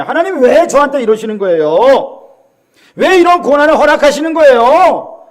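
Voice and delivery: loud, high-pitched plea; protesting question to God. Acted. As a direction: cry out 'why' to God, rising in pitch and force. Acting out a plaintive question. (하나님께 따지듯 높게 외치는 목소리)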